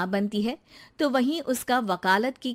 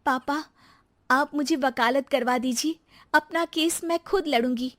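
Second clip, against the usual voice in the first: put-on higher young woman's voice, determined; determined daughter; 5 semitones higher than usual. Daughter asking her father to fund law studies, resolved. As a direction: ask your father firmly, voice up.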